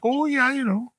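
put-on over-the-top, very high Wisconsin accent. Podcaster mocks neighbouring state, over-the-top Wisconsin accent. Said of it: "This Wisconsin's way over the top".

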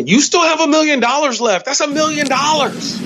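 high, emphatic disbelief; almost squeaking with disbelief; incredulous. Acted. Mocking, incredulous exclamation.

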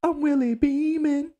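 high put-on movie line; Jamie Foxx impression. Streamer quoting Any Given Sunday, high put-on voice. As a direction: quote Willie Beamen like Jamie Foxx does. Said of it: "Jamie Foxx".

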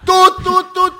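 high, loud busy-signal beeps; mock dial tone in the voice; imitated hang-up tone. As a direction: beep like a disconnected line. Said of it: "το κλείσα στη μούρη".

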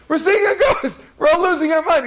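high-pitched comic wailing; mock panic. Mourners taking the returned Rav for a ghost, mock panic. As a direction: cry out in hammed-up fright.

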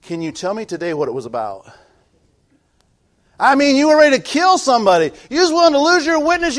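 usual speaking voice

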